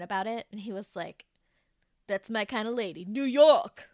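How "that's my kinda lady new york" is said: loud, brash New York guy voice; hammed-up New Yorker; mock New York accent. Imitating Doctor Mike with a loud New York twang. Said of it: "That's not at all what he sounds like".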